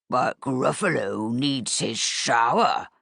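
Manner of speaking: put-on low, slow monster growl for the kids; growly Gruffalo voice. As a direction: play the Gruffalo to make the girls giggle. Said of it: "best growly Gruffalo voice".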